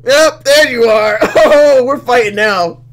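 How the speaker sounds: loud, high, laughing outburst; gleeful fighting talk. Real, not acted. Player spotting the culprit and ready to fight, gleeful. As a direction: crow it loudly through laughter.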